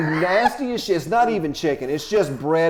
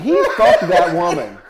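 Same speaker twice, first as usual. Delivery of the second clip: laughing fury; 11 semitones higher, 7 dB louder than usual. Real, not acted. Telling the hosts about the feature, a laughing, outraged rant. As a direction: rant it out, outraged but cracking up. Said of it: "I have never been madder in my fucking life".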